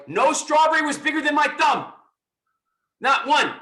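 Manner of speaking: loud, high, insistent exclamation; emphatic insistence. Real, not acted. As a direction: hammer the point home, loud and high. Emphatic point about strawberries of his childhood.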